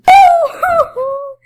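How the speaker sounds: loud high burst of laughter. Real, not acted. Laughing while playing.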